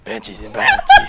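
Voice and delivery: very high-pitched mock impression; Cortana. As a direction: put on a high falsetto computer-companion voice. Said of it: "How about your Cortana?".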